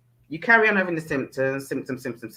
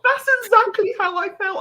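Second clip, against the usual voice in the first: much higher, thrilled; excited agreement; 4 dB louder than usual. Real, not acted. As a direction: gush agreement. Laughing agreement.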